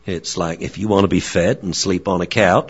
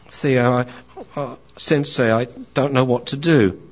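higher, eager then forlorn puppy; young dog voice; 7 semitones higher, 4 dB louder than usual. Acted. Young dog tells Theo about being left alone, higher. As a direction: chatter high like a lonely young dog. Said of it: "he's dealing with his wise friend".

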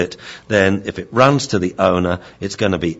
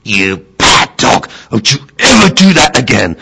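screamed scolding; 6 semitones higher, 10 dB louder than usual. Acted. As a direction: roar the telling-off, drawing out the words. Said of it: "screamed in my face".

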